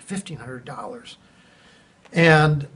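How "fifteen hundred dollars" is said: breathless whispered disbelief at the price; whispered shock; stunned whisper. Retold or imitated. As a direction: whisper it like the number knocked the air out of you. Shocked at tree removal price.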